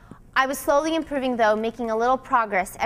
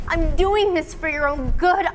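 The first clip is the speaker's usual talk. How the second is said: raised, higher, insistent cry; cried out; 6 semitones higher, 4 dB louder than usual. A narrator's reading. Girl justifying herself to classmate, crying out. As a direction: insist loudly over tears. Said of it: "I cried".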